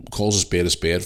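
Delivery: ordinary talk, nothing marked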